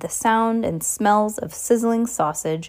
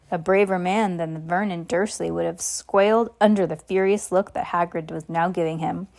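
plain reading voice

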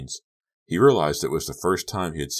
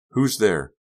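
calling out; 5 semitones higher, 7 dB louder than usual. A narrator's reading. Calls through door after pounding.

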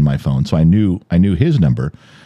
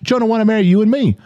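"Shona wanna marry you and me" blurred into one fast, high phrase; run together; 14 semitones higher, faster than usual. Retold or imitated. Host imitating friend's confusing delivery, words run together. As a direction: rush it in a mock Australian accent. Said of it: "he just ran it together like it was Chush".

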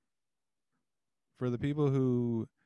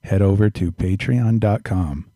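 big loud ad read; announcer voice; 12 dB louder than usual. Acted. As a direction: pitch the Patreon like a radio host. Patreon plug in announcer voice.

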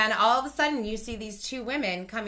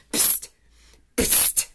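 hissed psst. Retold or imitated. Sneaking a call from bathroom.

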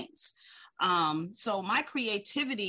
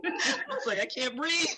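laughing retelling; 7 semitones higher than usual. Retold or imitated.

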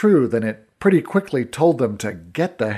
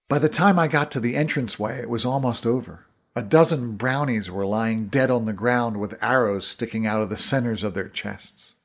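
ordinary delivery

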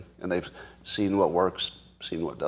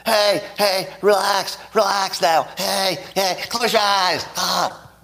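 loud, frantic high-pitched mock hypnotist; deliberately unsoothing, high urgent shouting; wrong hypnotist voice; 10 semitones higher, 3 dB louder, slower than usual. Shown as a demonstration. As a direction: order them to relax in an agitated high yell. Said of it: "in the wrong voice".

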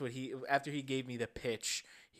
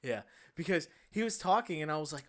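ordinary talk, nothing marked

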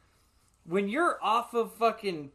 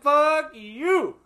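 mock taunt; 10 semitones higher, 10 dB louder than usual. Mock insult while driving off.

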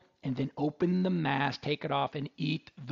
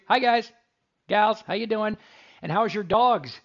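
loud calling out; 5 semitones higher, 7 dB louder than usual. Real, not acted. Radio host greeting brewery patrons, loud calling out. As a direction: shout a greeting across the brewery, louder.

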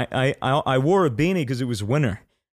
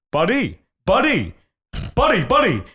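clerk calling out; 8 semitones higher, 4 dB louder than usual. Retold or imitated. Retelling the shop clerk calling out, higher and louder. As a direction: mimic the clerk calling after him. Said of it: "the guy behind the counter goes".

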